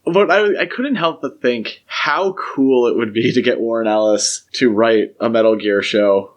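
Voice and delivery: usual speaking voice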